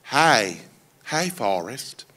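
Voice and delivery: soft, gently voiced little girl; Jenny. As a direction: softly offer a shy welcome. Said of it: "And then Jenny says".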